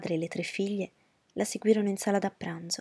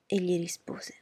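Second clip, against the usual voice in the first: ordinary delivery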